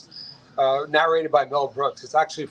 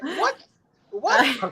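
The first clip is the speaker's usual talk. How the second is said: high-pitched, incredulous imitation Chinese accent; hammed-up Chinese-accented disbelief; mock Chinese accent; 10 semitones higher than usual. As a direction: play the stunned chef in a Chinese accent. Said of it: "In a very strong Chinese accent".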